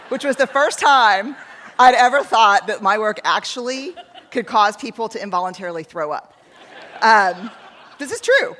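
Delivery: loud, slowed, laughing disbelief; real laughter at her own punchline; laughing while talking. Real, not acted. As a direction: push through real laughter.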